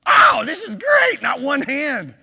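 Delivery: big ironic cheer, high and loud; mock triumphant shout. Real, not acted. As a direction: crow with fake delight at the silent room. Ironic cheer at no hands raised.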